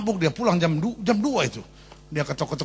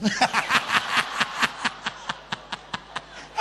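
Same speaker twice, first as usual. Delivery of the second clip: airy unvoiced laughter at the joke; breathless laugh; 5 semitones higher, 3 dB quieter than usual. Real, not acted. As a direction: let a soundless laugh out. Teller laughs at punchline.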